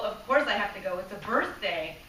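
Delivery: normal talking voice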